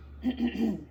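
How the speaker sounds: mock throat clearing before speaking. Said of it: "Frederick cleared his throat".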